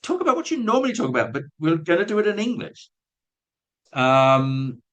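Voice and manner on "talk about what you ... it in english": higher, fast mock exasperation; teacher thinking about silent teens, fast higher exasperation; exasperated aside. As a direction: toss it off as if it's obvious. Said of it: "You just think".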